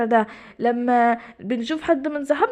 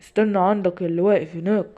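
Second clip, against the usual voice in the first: a lower spooky voice from hiding; disguised low voice; 6 semitones lower than usual. Acted. [name] hiding in the tree house calls Simba in a disguised voice. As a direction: drop the voice to sound like a stranger.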